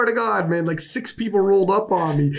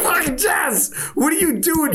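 laughing outcry; 8 semitones higher than usual. Retold or imitated. Laughing yell at Jess for spreading the rumor. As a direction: cry out at her through laughter.

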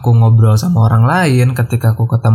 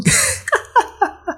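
high laugh; 6 dB quieter than usual. Real, not acted. Laughs at own exaggeration.

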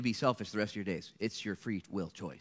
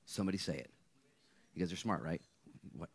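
ordinary talk, nothing marked

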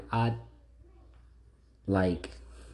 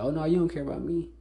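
mocking whine; 6 semitones higher than usual. Mocking the accuser's complaint.

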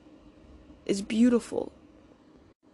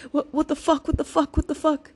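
rapid, higher-pitched retold self-attack; imitated self-berating; 4 semitones higher than usual. Speaker quoting horrible thoughts to herself, mock self-berating. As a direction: rattle it off like spiralling panicked self-talk, repeating faster. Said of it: "just all of these horrible things".

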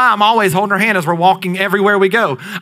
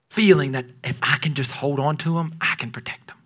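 much softer, whispered; tender whisper; 4 semitones lower, 6 dB quieter than usual. Acted. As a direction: hush it like holding a child. Parent clinging tenderly.

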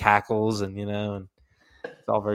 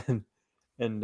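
ordinary delivery

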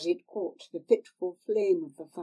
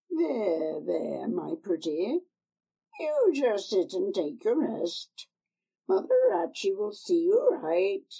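put-on old crone; 4 dB louder than usual. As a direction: coax her like a sly old crone. Old woman soothes Tanner.

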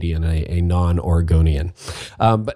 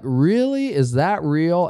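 a sudden jump up high on "Really?", then settling; imitating a doubting young pastor; incredulous; 7 semitones higher, slower than usual. Pastor playing a young reader's disbelief at the Bible, high and incredulous. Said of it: "you're gonna go like".

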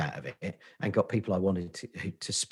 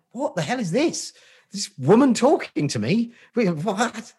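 mock bewildered outburst, higher and louder; replaying his own confused inner reaction; baffled reaction; 8 dB louder than usual. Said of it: "I'm like that".

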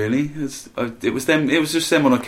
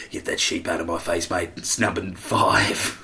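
mock New Zealand accent; 6 semitones lower, 6 dB quieter than usual. Mock New Zealand voice announcing chart position. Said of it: "In New Zealand, they said".

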